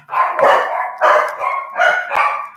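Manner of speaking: squeaky silent giggle; helpless high-pitched laughter at the dogs; high breathless laugh. Real, not acted. Laughing at the daughter's dogs barking at the door, breathless giggle.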